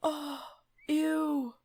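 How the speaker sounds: high, grossed-out oh ew; real disgust. Real, not acted. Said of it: "I'm saying that with me, not as Maris".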